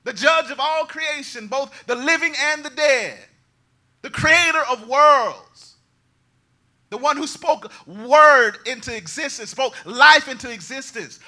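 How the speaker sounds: loud, high, ringing sermon climax; thundering revival preacher; full-force preaching. Acted. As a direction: preach it out to the whole congregation.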